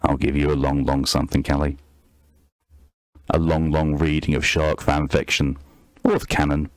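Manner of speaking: low, teasing put-on voice for a reader; deep reading voice. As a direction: drop down low to tease her. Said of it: "with this voice".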